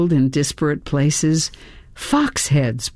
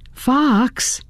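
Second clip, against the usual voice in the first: higher-pitched call to a friend; 7 semitones higher than usual. A narrator's reading. Narrator calls to curled-up fox friend, calling out. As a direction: call to a friend lying near. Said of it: "I called to my curled-up friend".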